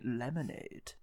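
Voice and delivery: soft, low, very serious British voice; Edgar Allan Poe. Retold or imitated. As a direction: say it low and grave, hushed. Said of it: "his very serious British voice".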